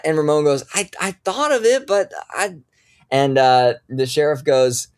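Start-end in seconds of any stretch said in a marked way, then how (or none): 0.7-2.6 flustered deputy. Acted. Ramon stammers excuse.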